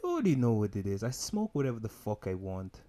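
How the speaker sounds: ordinary talk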